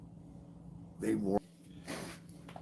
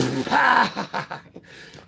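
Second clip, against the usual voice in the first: burst of laughter; 11 semitones higher, 6 dB louder than usual. Retold or imitated. Laughing at instructor's joke.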